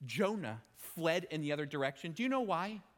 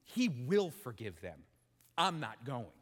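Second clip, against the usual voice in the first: low, flat certainty; lowered Jonah voice; 7 semitones lower than usual. Acted. Jonah refusing to go, low and stubborn. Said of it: "that he said".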